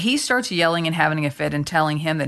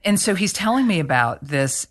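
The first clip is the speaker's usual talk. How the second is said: normal talking voice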